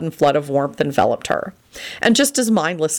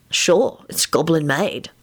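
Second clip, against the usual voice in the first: proud; slower than usual. A narrator's reading. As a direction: show it off modestly. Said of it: "Lupin replied proudly".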